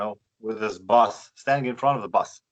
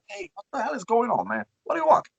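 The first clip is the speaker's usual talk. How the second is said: fast, much higher, mock-exasperated; exasperated retort; 9 semitones higher, faster than usual. Answering the shouting friend on the phone, fast high mock exasperation. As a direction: fire back high and quick, half annoyed.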